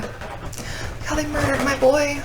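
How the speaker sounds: hushed, high, slow mock mourning; mock grief. Fan unboxing her beloved figure, hushed mock grief. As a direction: lament the toy like a fallen hero. Grieving over Ben Solo figure.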